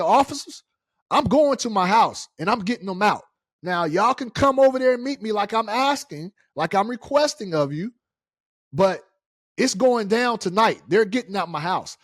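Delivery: loud, high, fast protest that he owns the house; angry retort. Retold or imitated. Owner telling the police officer he owns the house, loud and angry. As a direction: fire back at the officers, pitch climbing.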